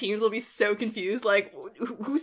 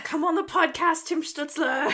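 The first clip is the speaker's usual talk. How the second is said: higher-pitched pleading call-out; mock plea, pitched up; playful calling out; 7 semitones higher than usual. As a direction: call out to a player who isn't there. Mock public plea to a player.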